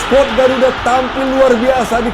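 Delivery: high excited play-by-play; goal call. Real, not acted. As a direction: keep the pitch up, celebrating. Third goal commentary.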